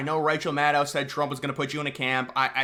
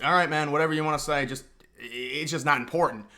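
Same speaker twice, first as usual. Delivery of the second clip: put-on humoring a kid. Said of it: "like, a six-year-old kid".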